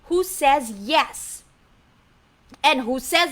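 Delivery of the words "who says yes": usual speaking voice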